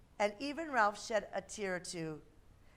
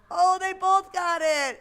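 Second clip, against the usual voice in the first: high, loud delighted cheer; excited; 8 semitones higher, 12 dB louder, slower than usual. Real, not acted. Excited game result.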